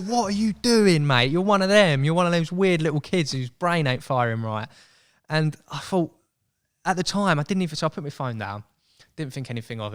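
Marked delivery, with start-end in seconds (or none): 0.0-4.7 higher, louder, slowed mock disbelief at himself; self-scolding. Teasing narrator scolding his younger self, mock outrage. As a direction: tell yourself off in disbelief.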